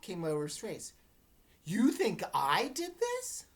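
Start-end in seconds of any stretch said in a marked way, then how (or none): 1.6-3.5 louder, slow, higher outraged question; indignant exclaim. A narrator's reading. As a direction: throw the accusation back at them. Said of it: "he exclaimed".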